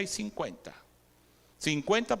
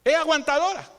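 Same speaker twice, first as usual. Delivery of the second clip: mock scolding voice; 7 semitones higher, 5 dB louder than usual. Mimics scolding voice, loud.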